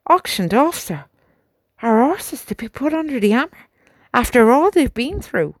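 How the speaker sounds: can't believe what he's hearing; shocked sergeant. A narrator's reading. Sergeant reacting to the major's auction news, high and loud.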